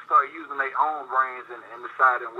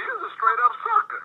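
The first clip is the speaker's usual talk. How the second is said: mocking scorn. Real, not acted. Mocking strip club spenders.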